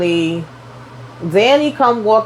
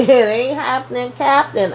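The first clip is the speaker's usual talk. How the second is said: "It ain't happening, Captain" said again through a laugh, rising higher on "Captain"; laughing repeat; 3 semitones higher than usual. Shown as a demonstration. Repeating his brush-off to the viewer with a laugh, higher at the end. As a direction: chuckle the line back, pitch climbing on "Captain". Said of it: "But it ain't happening, Captain".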